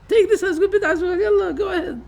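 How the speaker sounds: high, fast mocking voice of the other side; mocking imitation. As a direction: ham up the opponents egging you on. Mocking opponents' permissive voice.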